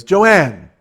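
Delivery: loud echoing call; calling back. Retold or imitated. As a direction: shout the name right back. Shaffer echoes the call.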